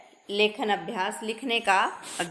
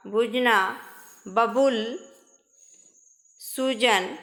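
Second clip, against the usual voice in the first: ordinary delivery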